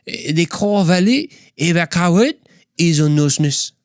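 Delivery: a bad French accent. Shown as a demonstration. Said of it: "What about French?".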